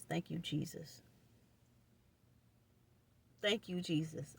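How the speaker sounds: soft, low murmured thanks; relieved thanks. Real, not acted. Thanking Jesus he's gone, a soft relieved murmur. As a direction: murmur it under your breath, relieved.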